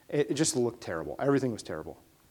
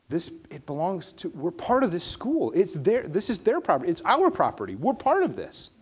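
rising conviction; 5 semitones higher than usual. Acted. Passionate inner conviction.

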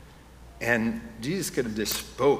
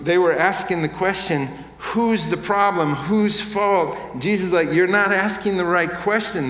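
louder, higher, emphatic preacher's delivery; impassioned preaching; 6 semitones higher, 5 dB louder than usual. Real, not acted. Preacher urges congregation to see God working, impassioned. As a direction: preach it out with rising force.

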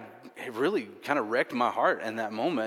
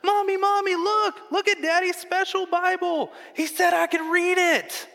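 high-pitched imitation of an excited kid shouting; super loud child; 7 dB louder than usual. Daughter running to show Mommy the Bible, super loud. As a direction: yell it like a thrilled little girl. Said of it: "just super, super loud".

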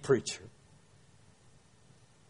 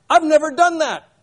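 higher and louder, caught off guard; startled protest; 9 semitones higher, 6 dB louder than usual. Retold or imitated. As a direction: blurt it out in surprise. Protesting to the caller.

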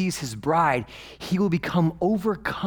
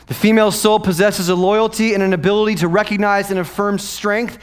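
louder, higher preaching climax; impassioned sermon; full-force preaching; 8 semitones higher, 8 dB louder than usual. Acted. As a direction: drive the point home to the congregation.

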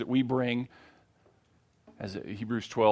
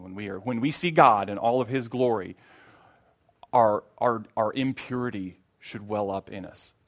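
usual speaking voice

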